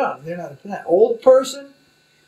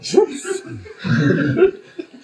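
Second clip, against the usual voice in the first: a high bursting laugh; real amused laugh, higher; 12 semitones higher, 3 dB louder than usual. Real, not acted. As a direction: laugh out loud at the thought. Amused by his own image.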